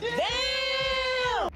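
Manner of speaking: surprised shout. Real, not acted. First bite of spicy wings.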